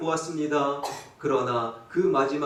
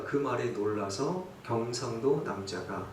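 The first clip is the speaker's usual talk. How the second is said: ordinary delivery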